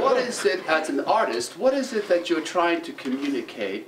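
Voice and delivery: put-on quoting a British mentor in his accent; attempted British accent. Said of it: "I can't do the British accent".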